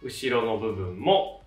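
ordinary delivery

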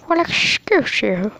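put-on slow, prim old lady voice; a hint of a scolding granny; grandma voice. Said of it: "do not be offended of my grandma voice".

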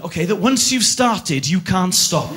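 ordinary delivery

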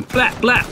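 high, staccato mouth-made gunshots; gunfire imitation. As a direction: mimic two shots of a gun.

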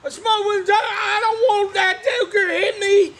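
hollering at the top of his voice; excited yelling. Real, not acted. Wrestling fan cheering for Smoking Joe, excited yell.

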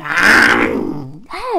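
loud open-mouthed lion roar. Acted. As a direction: let out a big roar. Lion king roars.

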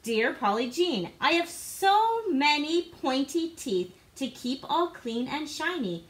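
ordinary delivery

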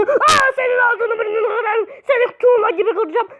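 high, hammed-up raging threat; play-rage yell; mock furious shout. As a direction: burst out screaming threats at the opponent. Mock rage at game opponent.

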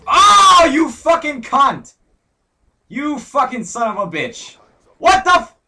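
high, loud, slow furious shout; screaming rage. Real, not acted. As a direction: scream at the killer in real fury. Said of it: "I rage too much".

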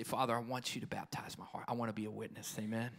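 soft hushed prayer to God; whispered prayer. Shown as a demonstration. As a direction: pray it barely above a whisper.

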